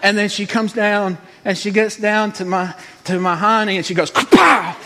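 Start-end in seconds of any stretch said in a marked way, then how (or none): 4.1-4.8 high, loud kapow; explosive mock karate-chop shout; imitated strike. Comic acting out the masseuse's blow, explosive shout.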